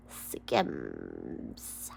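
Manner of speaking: a hint of a Kim Kardashian drawl; lightly put-on celebrity voice; lightly voiced Kim Kardashian. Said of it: "I'm sounding like Kim Kardashian".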